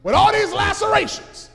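loud, high outraged rebuke; how dare you, at full voice; indignant rebuke. Acted. Lazarus to the rich man, loud outraged rebuke.

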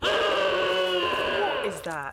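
loud slow startled cry; startled shout. Real, not acted. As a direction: shout it out in shock. Startled by zombie ornament.

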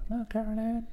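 high-pitched spooky voice; eerie high voice. Pretend. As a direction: coo it like a creepy ghost. Spooky cat voice continues.

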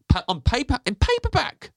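rising squeak. Acted. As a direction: voice climbing to a high squeak. Voice rising higher into paperback.

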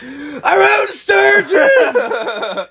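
high, slow, loud victorious yell; surfacing from the river and yelling to friends, a slow triumphant shout. Retold or imitated. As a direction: bellow it out like you just conquered a monster. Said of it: "I take a deep breath. I'm like".

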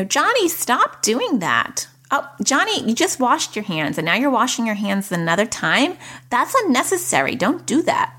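high-pitched, scolding New Jersey relative; New Jersey accent. Acted. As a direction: nag a child in a put-on New Jersey accent. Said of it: "that was my New Jersey accent".

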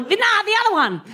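high, brash cockney correction; working-class mum. Retold or imitated. Mum correcting which kid she means, high cockney. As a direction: snap it like a London mum. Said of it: "she was working class".

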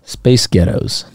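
slow American "Space Ghettos"; American accent. Shown as a demonstration. Co-host practising the accent trick, slow American accent. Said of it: "in an American accent".

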